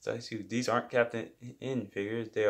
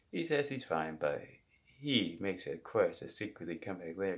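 ordinary delivery

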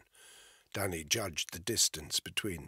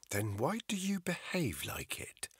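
accusing whisper; 10 semitones higher than usual. Acted. Armed woman accusing.

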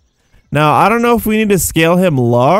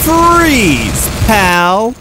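mock hero shout; 5 semitones higher than usual. Shouting a spell at enemy.

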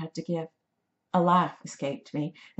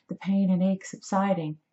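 ordinary delivery

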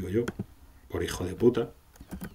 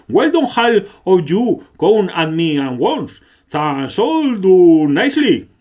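higher, louder and slow, a villain's challenge; slow booming villain; 7 semitones higher, 8 dB louder, slower than usual. Acted. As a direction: taunt the whole party at once, slowly.

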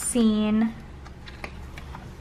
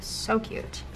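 soft unvoiced echo; hushed second so cute; whispered repeat; 9 dB quieter than usual. Real, not acted.

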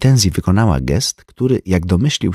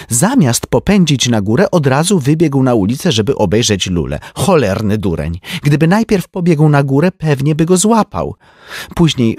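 high, lively society lady; a chatty rich woman gossiping; Tenzi's voice; 6 semitones higher, 3 dB louder than usual. A narrator's reading. (wysoki głos plotkującej damy)

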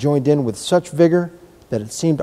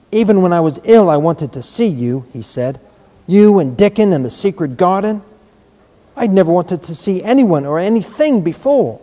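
put-on eager boy; 5 semitones higher, 5 dB louder than usual. A narrator's reading. Eager boy voiced in story.

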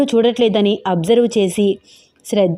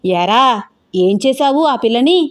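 mildly angry demand, rising at the end; angry questioning. A narrator's reading. Grandmother demands what he did to the girl, mildly angry. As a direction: scold him with a touch of anger. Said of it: "కోపంగా అడుగుతోంది అలివేలు".

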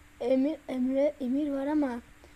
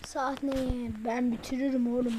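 ordinary delivery

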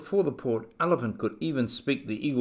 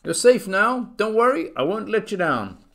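high, louder reassurance; calling back; 9 semitones higher, 6 dB louder than usual. A narrator's reading. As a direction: call it down to the tiny mayor. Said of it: "Horton called back to the mayor".

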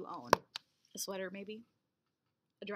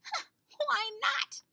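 put-on curious old grandpa; 14 semitones higher than usual. Grandpa asks.

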